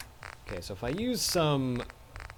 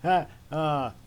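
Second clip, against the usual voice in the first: laughing; 6 semitones higher, 8 dB louder, much slower than usual. Real, not acted. Laughing at robot's apology.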